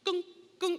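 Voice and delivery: high clunking noise; coaster clunk. Retold or imitated. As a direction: mimic the car tipping over. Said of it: "it rides like".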